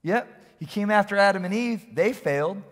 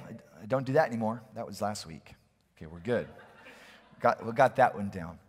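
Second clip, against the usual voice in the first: smug listener; 10 semitones lower, 8 dB quieter than usual. Pretend. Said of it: "some of you are looking at this and saying".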